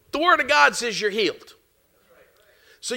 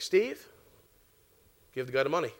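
still small voice; 9 semitones lower, 7 dB quieter than usual. Retold or imitated. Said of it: "still small voice".

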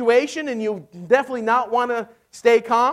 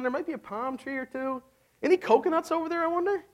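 lightly voiced, higher and softer holiday daydream; carefree Israelites; 5 semitones higher, 7 dB quieter than usual. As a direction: play the relaxed freed slaves musing about a beach. Israelites relaxing by the sea.

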